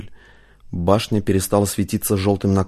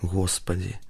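hushed, much softer than the narration; quiet exclamation; 9 dB quieter, slower than usual. A narrator's reading. Lucia climbs out at the awe-inspiring view, quiet exclamation. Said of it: "тихо произнесла".

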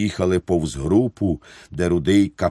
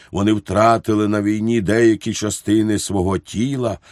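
plain reading voice

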